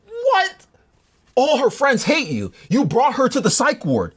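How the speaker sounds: high startled then slow incredulous; lightly hammed-up disbelief; mock shock. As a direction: act floored by the accusation. Hearing the guy's accusation.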